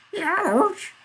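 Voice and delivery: very high-pitched, loud yelp; reedy elder. A narrator's reading. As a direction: squeal it like a pained old-timer. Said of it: "a reedy, high-pitched one".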